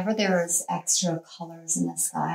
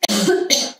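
sharp choking cough; sudden cough; 2 semitones higher, 7 dB louder than usual. Real, not acted. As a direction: cough as if something went down wrong. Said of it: "Excuse me".

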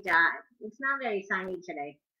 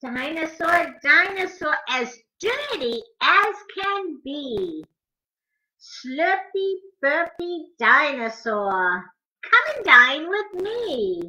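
lively, sing-song picture book rhyme, higher; reading to children; 4 semitones higher, 6 dB louder, slower than usual. Acted. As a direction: bounce the rhymes for little listeners.

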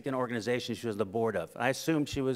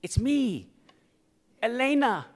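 eager reintroduction; 8 semitones higher than usual. Retold or imitated. Old friend insists to Rick she is the astronaut, growing excited. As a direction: insist brightly, climbing with each phrase.

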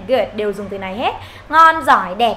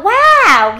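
"wow" high and loud with raised voice; big surprised exclamation, pitched right up; amazed wow; 8 semitones higher, 9 dB louder than usual. Shown as a demonstration. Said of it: "cái từ wow này các bạn phải lên giọng".

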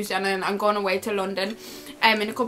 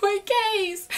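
1 helpless whine; 10 semitones higher than usual. Retold or imitated. Retold helpless reply.

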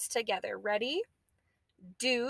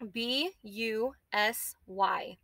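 slow, letter by letter; spelled out letter by letter; much slower than usual. Shown as a demonstration. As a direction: spell it out slowly, each letter clear. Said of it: "spelling the word aloud".

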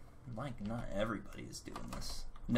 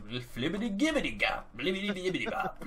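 gibberish typing; 9 semitones higher, 4 dB louder than usual. Retold or imitated. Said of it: "It's like".

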